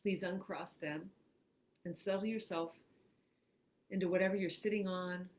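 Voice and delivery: unhurried phrases with long pauses; guided meditation. Acted. Host reads a relaxation script to online listeners, guided meditation. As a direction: guide them through breathing, leaving room after each phrase.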